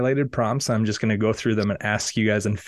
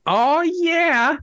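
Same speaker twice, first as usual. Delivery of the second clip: high, louder yelp of ow; yelling; 4 dB louder than usual. Acted. Ex-coach runs around the room yelling, high loud yelp. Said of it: "running around yelling".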